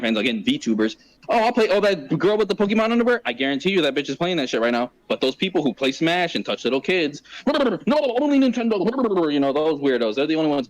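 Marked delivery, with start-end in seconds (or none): 1.3-3.2 higher-pitched mock enthusiasm; a hint of an excited streamer; imitated eager fan. 7.4-9.3 high, drawn-out fanboy protest; hammed-up fanboy tantrum; mock whiny fanboy. Mocking whining Nintendo fanboys.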